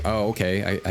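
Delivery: normal talking voice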